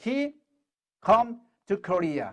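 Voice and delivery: very slow, word by word; slow classroom drill; slow practice read. Shown as a demonstration. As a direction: drill it slowly, each word apart. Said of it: "하고 이제 자연스럽게 말하면 돼요".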